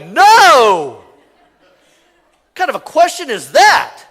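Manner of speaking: laughing mock shout of "No!"; emphatic no. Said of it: "And you're like".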